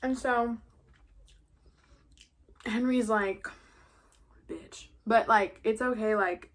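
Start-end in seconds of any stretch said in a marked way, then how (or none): 4.5-4.9 whispered insult. Retold or imitated. Henry reacting under breath.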